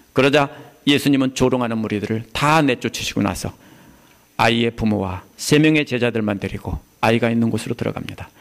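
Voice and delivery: usual speaking voice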